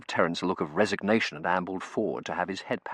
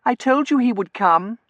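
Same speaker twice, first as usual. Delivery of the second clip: put-on excited girl; 10 dB louder than usual. A narrator's reading. Excited girl tells her cousin.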